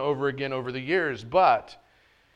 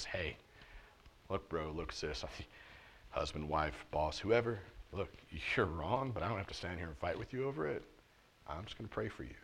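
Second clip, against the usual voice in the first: calm, gentle reply; 7 semitones lower, 13 dB quieter than usual. Pretend. Calm Jesus-like reply to opponents.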